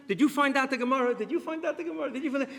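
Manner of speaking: put-on high, hurried kid asking friends; the son pestering his friends; mock anxious son. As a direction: son phoning friends about the Gemara, lightly voiced. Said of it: "He's calling his friends".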